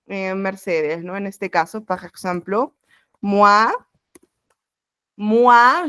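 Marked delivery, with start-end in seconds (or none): 3.2-5.9 moi said twice, louder, as mwa; repeated moi. Shown as a demonstration. Teacher drills moi for the students, louder. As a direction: lean on each moi. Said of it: "se pronuncia como U A".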